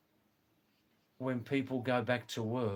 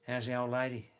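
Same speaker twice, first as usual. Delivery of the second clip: put-on Australian slur, "How's the old lady" run together; broad Aussie vowels on "old lady"; broad Australian accent; faster than usual. Shown as a demonstration. Showing listeners how Australians ask after the wife, broad Australian accent. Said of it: "the other half talk like this".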